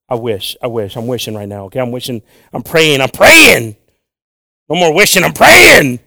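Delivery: from loud to shouting high; building shout. Real, not acted. Preacher insisting he's praying, building to a shout.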